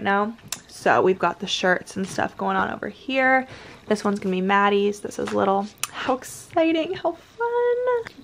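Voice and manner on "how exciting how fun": high squeal of delight. Real, not acted. Squealing over the little's shirt.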